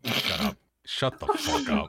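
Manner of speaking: yelping shut up, voice jumps high; high squeaky shut up. Real, not acted. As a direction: squeak it out, flustered. Teased, snaps back.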